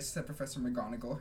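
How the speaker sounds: plain reading voice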